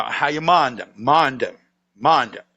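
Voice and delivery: "mind them" as MA'N'EM, repeated for the listener; Southern contraction. Shown as a demonstration. Storyteller corrects cohost's full version, run together as ma'n'em. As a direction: stress MA'N, swallow "them" into 'em. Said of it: "you're mentioning the mother".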